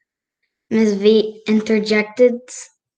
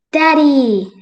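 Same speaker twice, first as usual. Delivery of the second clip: bright call to her dad, a touch louder and higher; 6 semitones higher, 6 dB louder than usual. Acted. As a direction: call out happily across the yard. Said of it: "she calls out".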